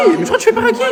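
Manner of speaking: high squeaky mocking voice; laughing high mock of the tough guy; squeaky mock taunt. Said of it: "Faut juste pas le calculer".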